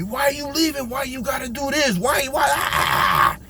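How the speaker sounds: imitating robbers yelling after him; mock yell. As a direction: squeal the questions like the robbers did. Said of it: "now they're yelling at me".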